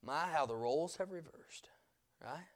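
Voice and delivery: hushed, whispered wonder; soft whispered aside. Real, not acted. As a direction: breathe it like a secret. Whispered, then said aloud.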